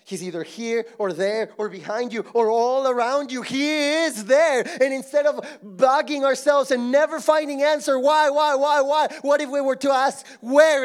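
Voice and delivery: loud, high-pitched pulpit preaching; preaching at full force. Acted. Pastor urging the congregation, preaching loud. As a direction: drive it home to the congregation.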